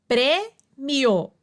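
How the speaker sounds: "prêmio" split as prê-mio; divisão silábica. Shown as a demonstration. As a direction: pause after prê in "prêmio", keep mio whole. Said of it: "semivogal mais vogal na mesma sílaba". ("prêmio" pausado, em prê-mio)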